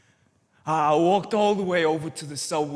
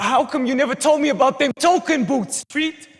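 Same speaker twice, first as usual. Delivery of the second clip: loud, high accusing shout; 6 semitones higher, 6 dB louder than usual. Acted. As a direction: yell it at him, hurt and furious. Character confronts Treat, shouting.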